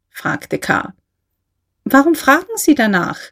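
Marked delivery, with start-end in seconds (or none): 1.8-3.2 higher-pitched, irritated retort; annoyed. A narrator's reading. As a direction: push back with mild annoyance. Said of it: "sagte der Kaufmann ärgerlich".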